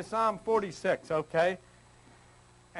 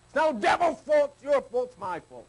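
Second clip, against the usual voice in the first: louder and higher, pushing back hard; emphatic rebuttal; 4 semitones higher, 3 dB louder than usual. Real, not acted. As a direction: correct them firmly.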